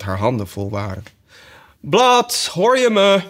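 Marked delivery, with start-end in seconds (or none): 1.8-3.2 high, loud sheep cry; sheep calling loudly. Acted. Sheep demands Alice's attention, high and loud. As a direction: call out sharp and impatient.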